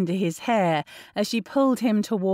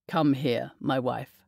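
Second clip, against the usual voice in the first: a hint of a lower, softer teasing husband; lightly voiced husband; 6 semitones lower, 4 dB quieter than usual. A narrator's reading. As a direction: coax her over in a low murmur. Narrator voices Darcy.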